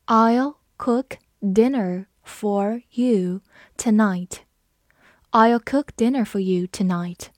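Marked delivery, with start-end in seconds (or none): 0.1-4.4 very slow, one word at a time; slow read-along. Shown as a demonstration. Teacher leading learners through the dinner sentence, slow read-along. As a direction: spell out the sentence word by word, with pauses. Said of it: "好，我们慢读一遍".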